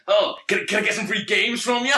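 high-pitched mock LA chancer; douchebag from LA. Seatmate angling for free games, mock douchebag. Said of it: "this absolute douchebag from L A".

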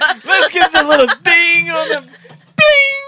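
loud, high laughing shout; excited shout. Real, not acted. Host celebrating a correct answer with a laughing shout. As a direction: whoop it out with a laugh.

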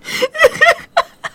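sudden high cry of laughter; high laughing shriek. Real, not acted. Host breaks into a high shrieking laugh mid-rant. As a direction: crack up into a squeal.

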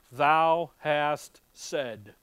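slow, loud sarcastic drawl; dripping sarcasm. Acted. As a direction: draw it out with heavy sarcasm. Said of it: "just dripping with sarcasm".

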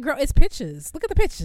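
loud, high-pitched mock exasperation at commenters; exasperated. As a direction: throw it back at the commenters loud. Exasperated reply to confused commenters.

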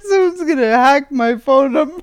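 high, loud panicky laugh-talk; worried and giggling; excited worried. Real, not acted.

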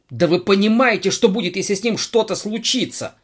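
high, loud angry growl; growling angrily. Acted. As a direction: growl at them in fury. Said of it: "рычал директор". (рычит в гневе)

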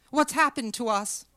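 acted distress before breaking down; tearful distress. A narrator's reading. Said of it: "And she began to cry".

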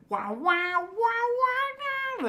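very high sung sax wails; sexy saxophone imitation. Imitating the sax cue in Clone Wars. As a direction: croon like a sultry saxophone riff. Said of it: "the sexy saxophone".

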